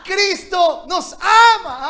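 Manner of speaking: shouted answer. Real, not acted. Preacher shouts the answer with the church, tearful. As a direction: shout it out, voice breaking. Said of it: "Dígalo más fuerte".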